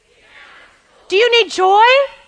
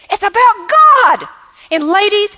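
usual speaking voice

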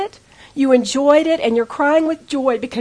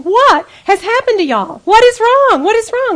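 alarmed, loud, high-pitched questioning; bewildered father; 9 semitones higher, 6 dB louder than usual. Retold or imitated. Dad to his sobbing, hugging family, loud bewilderment. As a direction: imitate a baffled dad demanding an explanation.

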